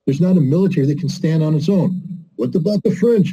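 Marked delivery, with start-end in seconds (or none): 2.4-3.3 higher, challenging question; imagined objector. Acted. Audience objects to the speaker about the French, raised questioning voice. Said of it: "you might say, with a better French accent".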